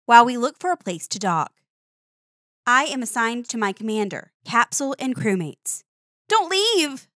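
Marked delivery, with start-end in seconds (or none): 6.3-7.1 high, pleading cry; pitched-up little plea; begging. Acted. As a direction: plead with mom not to go. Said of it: "I beg".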